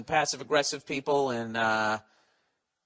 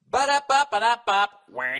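mock fanfare; 7 semitones higher, 6 dB louder than usual. Real, not acted. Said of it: "I suck at reading out loud".

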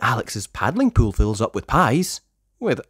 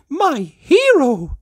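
much higher, slow adoring sigh; swooning mayor; 6 dB louder, much slower than usual. Acted. Mayor Goodway thanks Rocky, high and swooning. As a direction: gush over your rescuer. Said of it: "she says".

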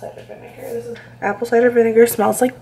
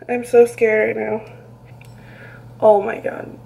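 ordinary delivery

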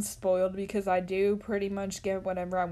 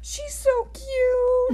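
higher, slow cooing over a cute dog; 14 semitones higher, 6 dB louder, much slower than usual. Real, not acted. A kid gushing over the streamer's dog, slow and high. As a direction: stretch it out, melting at the puppy.

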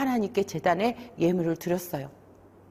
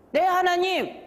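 higher, acted reply; answering a call; 8 semitones higher than usual. Cain replying as God calls him, higher acted answer. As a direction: respond promptly in a raised pitch.

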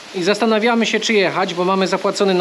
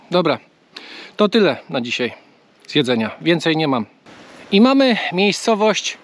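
ordinary talk

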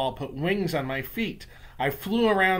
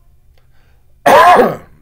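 witch cough; 10 semitones higher, 21 dB louder than usual. A narrator's reading. Witch outburst.

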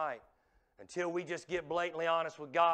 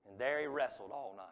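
normal talking voice

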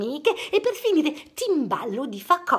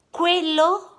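usual speaking voice

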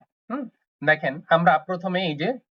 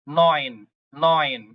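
Nayan repeated slowly, falling; again draw out Nayan, calmly; slow German neun; 2 semitones lower, much slower than usual. Shown as a demonstration. Teacher repeats German nine slowly for students.

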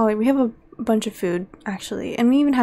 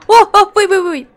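loud, higher alarmed calls; alarmed shout; 8 semitones higher, 10 dB louder, much faster than usual. Real, not acted. Player shouting as the mummy attacks. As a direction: yell to stop it. Fight starts suddenly.

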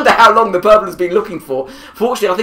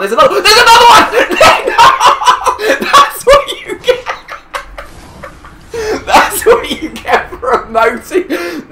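much higher, loud howling laughter through the words; laughing fit; 14 semitones higher, 5 dB louder than usual. Real, not acted. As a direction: crack up at the comeuppance. Enemy killed mid-emote, cracking up.

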